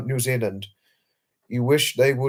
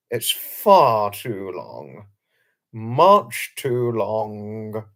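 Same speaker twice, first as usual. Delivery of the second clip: drawn out; 3 semitones lower, much slower than usual. Real, not acted. Emphatic refusal, very drawn out.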